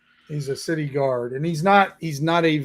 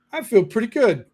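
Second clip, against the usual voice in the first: put-on much higher, cocky kid voice; cheeky halfling boy; 7 semitones higher than usual. Halfling boy answering the city guard, cheeky high voice. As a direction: play a street urchin who is not sorry. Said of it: "he goes".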